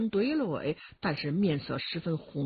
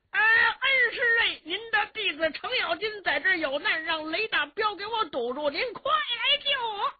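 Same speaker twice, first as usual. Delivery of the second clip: desperate high call; 13 semitones higher, 3 dB louder than usual. Acted. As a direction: wail up to the master for rescue, stretching the last words. Extra desperate call for rescue. (高声拖腔哭喊，向恩师求救)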